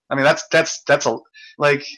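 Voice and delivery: ordinary talk, nothing marked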